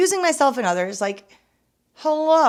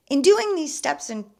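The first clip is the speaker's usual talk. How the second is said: ordinary talk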